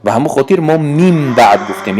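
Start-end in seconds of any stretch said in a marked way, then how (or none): 0.8-1.6 میم بعد with the N changed into a held M before the B; nun turned to mim. Shown as a demonstration. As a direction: hum an M in place of N in میم بعد. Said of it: "نون رو به میم تبدیل دادیم". (میم بعد با تبدیل نون به میم)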